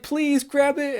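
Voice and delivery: high, loud, desperate "don't"; pleading from the couch, controller gripped; anxious pleading. Retold or imitated. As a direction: beg the screen not to let it happen. Begging the game character to act.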